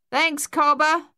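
high, loud call across water; calling from afar. A narrator's reading. Fleeing swimmer on the far bank to Luther, calling out. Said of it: "a voice called from the far bank".

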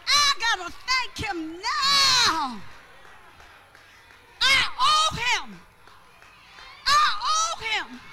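high, loud, slow shouted praise; full-force preaching. Real, not acted. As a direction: holler each phrase and stretch the last word.

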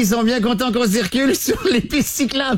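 very high voice, laughing through it; laughing Momo. Retold or imitated. Momo laughs at the host's fear of police, very high.

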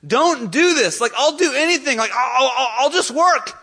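high-pitched, loud acted plea; hammed-up begging, pitched high. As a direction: plead desperately as a servant before the king. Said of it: "he's begging the king".

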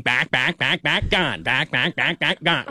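lightly imitated broadcaster home run call; a hint of Chris Berman calling a homer. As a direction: sing out each back like the ESPN call. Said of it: "Remember when Chris Berman used to do that thing?".

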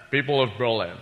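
put-on slurred Scottish-accented presidential address; Scottish film star playing the president, s's turning to sh; Sean Connery. Impressionist does the president's Berlin speech, slurred Scottish impression. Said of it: "Sean Connery doing, uh, President Kennedy".

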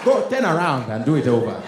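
put-on loud, scolding Nigerian accent; Nigerian GPS. Nigerian GPS berating driver, loud Nigerian accent. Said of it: "a Nigerian on a G P S".

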